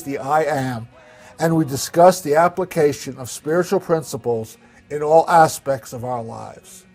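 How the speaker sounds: big, booming show opening; announcer voice. Acted. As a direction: read it like a broadcast promo. Scripted show opening over music.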